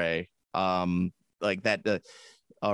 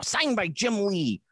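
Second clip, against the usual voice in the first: mock pitchman; 9 semitones higher, slower than usual. Mock Stan Lee sales pitch.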